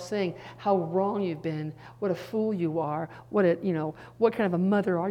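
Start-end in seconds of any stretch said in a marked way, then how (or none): none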